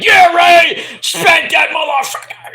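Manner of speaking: mock commentator shouting, high and loud; Michael Cole. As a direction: yell like a wildly partisan announcer. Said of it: "freaking Michael Cole, the whole fucking match, like".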